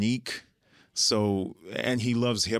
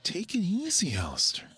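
hushed warning; 10 semitones higher than usual. Retold or imitated. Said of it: "I'm like".

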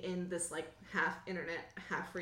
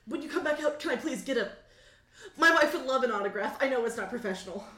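louder, higher, tumbling plea; nervous request; 4 semitones higher, 7 dB louder, faster than usual. Acted. Bouncer asking a star for his wife's autograph, nervous and eager. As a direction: blurt out a sheepish fan request. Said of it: "he ushers you in very nervously".

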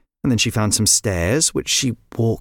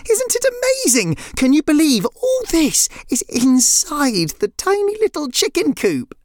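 high, amazed exclaiming; excited cry. A narrator's reading. Neve amazed inside the tiny coop, excited cry. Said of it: "cried Neve".

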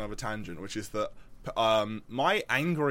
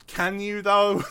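high-pitched mock doubt, louder at the end; sceptical retort; 9 semitones higher, 8 dB louder, slower than usual. Student doubting the teacher's offer to explain, sceptical retort. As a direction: question it in a raised, incredulous voice.

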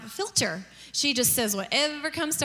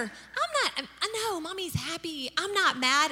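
high, softened mock-cheerful denial; strained-high mom protesting to a child; defensive protest; 4 semitones higher than usual. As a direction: insist in a too-sweet high voice. Mom protesting she is not angry.

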